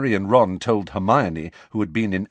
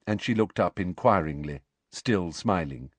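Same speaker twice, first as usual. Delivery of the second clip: ordinary delivery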